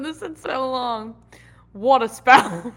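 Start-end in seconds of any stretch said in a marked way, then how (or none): 1.7-2.7 loud, drawn-out Cajun "Water spout"; Cajun accent. Retold or imitated. Imitating a Cajun accent, loud. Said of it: "my impression of a Cajun person saying water".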